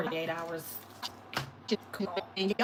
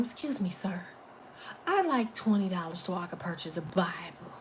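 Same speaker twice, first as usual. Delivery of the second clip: softer, lower, overly polite request; mock polite asker; 5 semitones lower, 6 dB quieter than usual. Mock-asking a stranger for Bible money, softly polite. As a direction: play a timid, proper beggar. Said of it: "ask them".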